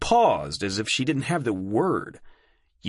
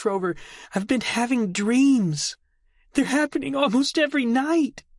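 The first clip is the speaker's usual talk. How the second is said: put-on high, urgent girl voice; young woman voice; 13 semitones higher than usual. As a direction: confess it in a rush. Delphi confessing dreams.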